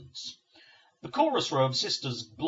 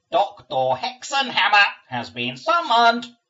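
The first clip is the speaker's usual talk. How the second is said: slow, loud, high grating nurse; grating demon voice; 9 semitones higher, 13 dB louder, slower than usual. Acted. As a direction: grate each word out like a demon nurse. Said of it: "grates the middle sister".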